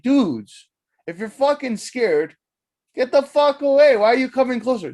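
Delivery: building to a high, loud yell; rising comic outrage; exasperated scolding. Acted. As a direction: yell at foolish pets in disbelief. Scolding his cats in story.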